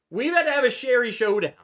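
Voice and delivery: high, fast, loud hype; excited shout. Acted. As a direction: shout it like a big announcement. Excited announcement of episode.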